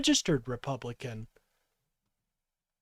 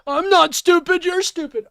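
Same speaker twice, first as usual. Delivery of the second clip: high, loud shouted retort; furious shout; 13 semitones higher, 11 dB louder than usual. Real, not acted. Streamer shouting back at the man in the clip, furious. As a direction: scream it back like a schoolyard comeback.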